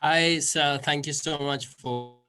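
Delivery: ordinary talk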